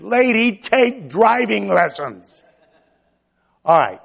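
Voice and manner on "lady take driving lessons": impatient driver. Retold or imitated. Driver yelling at a slow lady driver, mock exasperated. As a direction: scold the slow driver in jest. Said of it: "Have you ever said".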